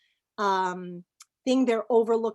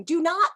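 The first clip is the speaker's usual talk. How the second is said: "do not" punched higher and louder; emphatic do not; 7 semitones higher, 5 dB louder than usual. Real, not acted. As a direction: lean hard on "do not" as a warning. Warning beginners, emphatic.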